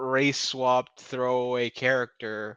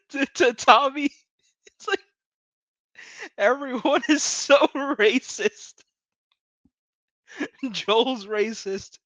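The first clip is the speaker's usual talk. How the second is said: high, laughing exasperation; half-laughing at how absurd it is; laughing disbelief; 11 semitones higher than usual. Real, not acted. Ranting at the characters, laughing in disbelief. Laughing rant about racism.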